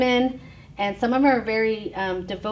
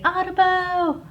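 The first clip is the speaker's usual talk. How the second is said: higher, slow, light mock "Audubon"; imitating the boy; 9 semitones higher, slower than usual. Teacher recalling the boy's call, lightly imitated. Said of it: "he'll say".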